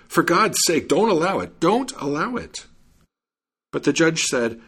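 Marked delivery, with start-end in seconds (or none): 0.1-2.7 an alarmed outcry, begging them to stop; raising a commotion. A narrator's reading. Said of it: "began raising a great commotion".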